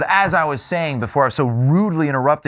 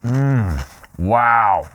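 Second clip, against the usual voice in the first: savoring mmm; 2 semitones lower than usual. Real, not acted. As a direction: savor the bite. First bite of the dip.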